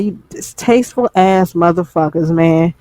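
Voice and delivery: ordinary talk, nothing marked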